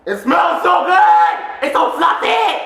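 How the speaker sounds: high, loud, stretched-out squeal; excited shout. Real, not acted. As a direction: shout it, completely carried away. Same words, carried away by excitement.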